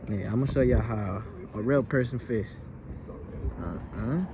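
ordinary talk, nothing marked

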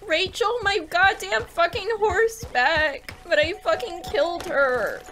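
high, upset lament; tearful. Real, not acted. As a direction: plead for them back, close to crying. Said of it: "I don't even know why I'm crying".

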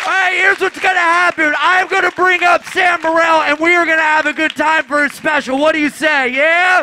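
loud hype host voice to pump the crowd; rally the room loudly for the special; announcer hype. Acted. Hyping crowd for taping.

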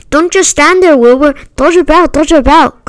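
urgent high-pitched calling out; urgent cry. A narrator's reading. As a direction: cry out commands, pitched well up. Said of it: "cried the goose".

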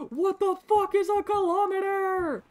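high, loud comic outburst; mock-outraged yell. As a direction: holler it like a baffled patriot. Mock outrage at metric units.

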